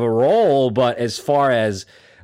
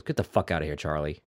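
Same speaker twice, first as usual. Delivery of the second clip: sudden cold switch; 7 semitones lower, 8 dB quieter, faster than usual. Retold or imitated. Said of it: "it just changed".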